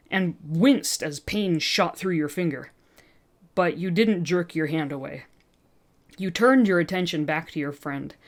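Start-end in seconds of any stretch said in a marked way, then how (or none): none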